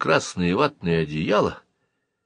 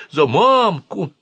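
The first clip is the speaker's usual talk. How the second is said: urging; 10 semitones higher, 4 dB louder, much slower than usual. A narrator's reading. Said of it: "бормотал он".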